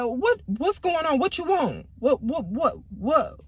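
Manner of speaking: flustered, high stammering; nervous stammer answering the phone; stuttering. Retold or imitated. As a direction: trip over the words like you're caught off guard. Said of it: "I just was stuttering".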